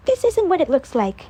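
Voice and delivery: a sister caught out, insisting calmly; Jera, pleading. A narrator's reading. Jera's voice in story.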